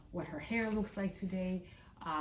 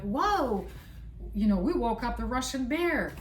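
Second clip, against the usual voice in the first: higher, mock-startled reaction; raised pitch, retold bewilderment; imitated astonished onlookers; 4 semitones higher, 3 dB louder than usual. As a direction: act out people taken aback. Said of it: "people would be like".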